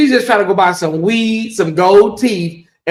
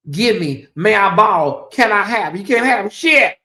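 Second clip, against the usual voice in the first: normal talking voice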